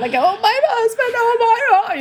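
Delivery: very high, wailing "oh my husband, oh my God"; mock martyr whine. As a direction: ham up a self-pitying victim. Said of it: "I'm like".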